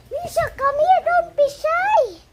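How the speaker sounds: high coaxing pet voice; coaxing call. Real, not acted. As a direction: sweet-talk the pet out of hiding, pitched high. (malambing na mataas na tawag)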